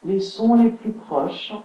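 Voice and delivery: unhurried yoga nidra guidance with long pauses; guided relaxation. Acted. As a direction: settle listeners into stillness, phrase by phrase. (voix posée de relaxation guidée)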